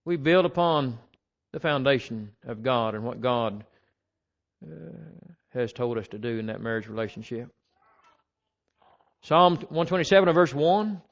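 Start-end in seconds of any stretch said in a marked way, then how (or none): none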